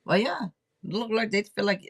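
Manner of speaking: ordinary talk, nothing marked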